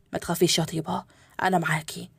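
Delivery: low, soft comforting; calm her down gently and softly; husband soothing his frightened wife; tender reassurance. Acted.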